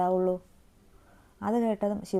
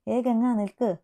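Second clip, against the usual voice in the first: louder, higher call after her; 4 semitones higher, 5 dB louder than usual. A narrator's reading. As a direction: call her back as she walks off. Calling after leaving Ganga. (പോകുന്ന ഗംഗയെ ഉറക്കെ വിളിച്ചു)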